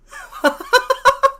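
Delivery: a loud, high-pitched laughing fit. Real, not acted. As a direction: cackle out loud at the joke. Laughs at chat's answer.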